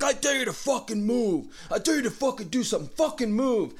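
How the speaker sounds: high, pushed daring threat; retold threat. Woman with shotgun to his head, high threatening yell.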